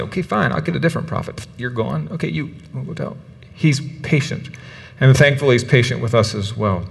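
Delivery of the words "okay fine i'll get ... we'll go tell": 1 dismissive God. Retold or imitated. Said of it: "God could just take him out and say".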